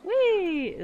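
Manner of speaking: playful whee. Pretend. Playful camera ride.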